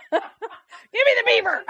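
laughing through the words, high. Real, not acted. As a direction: giggle while coaxing the dog. Laughing while coaxing dogs.

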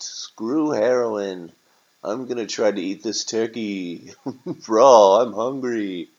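a hint of a hungry drifter, emphatic; homeless man. Homeless man eyeing a turkey to eat, desperate.

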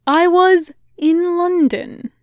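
put-on the soldier showing off a slow, high American accent. A narrator's reading. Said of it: "accent surprisingly American".

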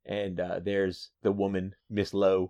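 usual speaking voice

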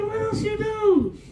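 high, drawn-out mock shout; imitating amazed questioner. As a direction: stretch it out high like an astonished listener. Repeats brother's amazed question.

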